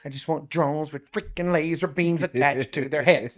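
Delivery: light put-on movie-villain voice; Austin Powers villain quote. To cohost after his laser beams line, a lightly voiced movie villain quote. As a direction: toss off a hint of the villain's demand. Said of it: "hashtag Austin Powers".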